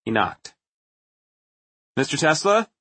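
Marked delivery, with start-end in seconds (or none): 2.0-2.7 calling out. A narrator's reading. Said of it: "he called".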